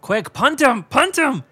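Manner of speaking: high, loud urging at the screen; real excited yell at the screen; excited shout. Real, not acted. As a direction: cheer them on, yelling.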